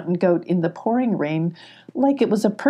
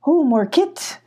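high surprised goat exclaim; the goat caught off guard; startled goat; 8 semitones higher, slower than usual. Acted. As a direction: goat cut off mid-word by surprise. Goat startled by big dog.

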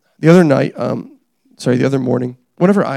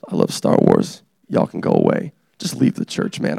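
croaky aside. Pretend. Gravelly aside after Vader bit.

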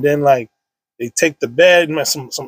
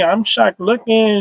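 usual speaking voice

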